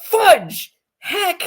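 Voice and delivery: high, prim minced oaths; mock-clean swearing. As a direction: say them like a goody-two-shoes. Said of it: "I'm like".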